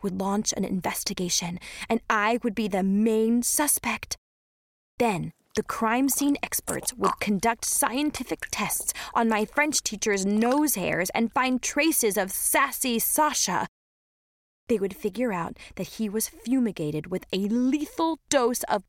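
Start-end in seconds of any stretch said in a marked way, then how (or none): none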